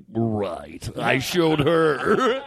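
smug aside. Retold or imitated. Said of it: "be like".

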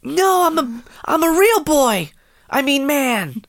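put-on high squeaky anime boy; high cute anime girl. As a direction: pitch it up like a cartoon kid. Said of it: "Do your best anime voice".

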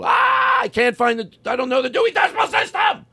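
high hammed-up shout over nothing; mock panicked shout. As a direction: fake a frantic yell over trivia. Mock panic over library trivia.

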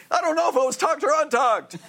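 lightly voiced flustered hygienist, pitched high; high mock hygienist. Hygienist protesting to Jerry in a high mock voice. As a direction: squeak it out innocently.

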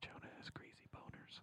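voiceless whisper, mouth covered; whispering behind hand. Pretend. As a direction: whisper secretly with the mouth hidden. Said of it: "Now I'm getting upset".

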